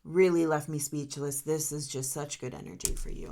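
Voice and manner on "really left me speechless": usual speaking voice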